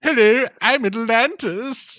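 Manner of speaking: high, slow dopey voice; dumb guy voice. Retold or imitated. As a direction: play Atlantis as a dim-witted guy. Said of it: "the dumb guy voice".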